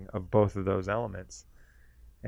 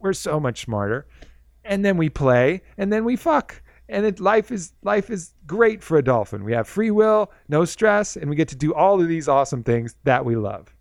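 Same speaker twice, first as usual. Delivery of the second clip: much higher, louder, bubbly greeting; cheerful dolphin spirit greeting a visitor; super happy dolphin; 7 semitones higher, 5 dB louder than usual. Retold or imitated. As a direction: beam like the happiest creature alive. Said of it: "happiest spirit".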